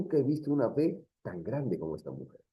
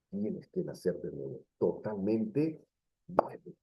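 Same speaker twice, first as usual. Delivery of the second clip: énfasis en voz baja; 4 semitones lower, 7 dB quieter than usual. Real, not acted. Cierra en voz baja y lenta.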